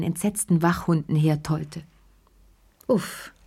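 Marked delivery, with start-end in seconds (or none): 2.9-3.3 relieved sigh on a short word. A narrator's reading. Woman alone back home, sighing. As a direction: let it out as a sigh. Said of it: "seufzte Lea".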